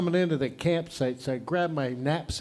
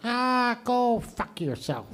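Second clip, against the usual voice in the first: higher squawk, then slow swearing; parrot voice; 6 semitones higher, slower than usual. Acted. Parrot back from the fridge, squawking defiantly. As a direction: drag out the curse as a parrot. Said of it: "The parrot goes".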